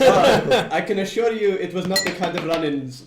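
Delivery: laughing. Real, not acted. Laughing in-character reply.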